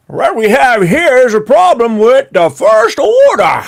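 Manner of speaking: put-on high, slow hammy accent; theme park role player. Park actor announcing trouble to guests, high hammed-up voice. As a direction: overact a dramatic announcement, drawn out. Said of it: "now everyone's like".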